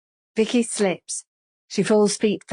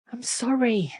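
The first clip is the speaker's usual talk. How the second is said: whispered apology; 5 semitones higher than usual. A narrator's reading. Vicky pleading.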